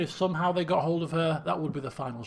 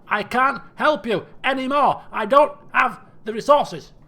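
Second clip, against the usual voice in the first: exasperated rant, growing louder; fed-up king; 3 semitones higher, 9 dB louder than usual. Acted. King sick of petitioners and their problems, exasperated. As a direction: punch out each complaint, building in force.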